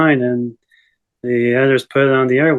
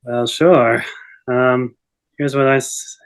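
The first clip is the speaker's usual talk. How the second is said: ordinary delivery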